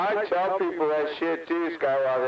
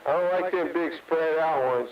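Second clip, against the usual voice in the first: normal talking voice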